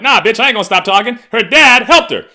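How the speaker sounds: loud, high, fast defiant retort; defiant shout. Retold or imitated. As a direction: yell back without backing down. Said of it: "I'm like".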